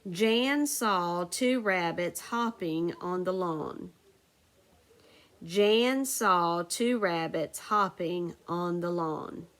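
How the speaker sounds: slow, clear word-by-word reading; careful slow dictation pace. Shown as a demonstration. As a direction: read it out slowly so students can write it down. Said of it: "so listen carefully".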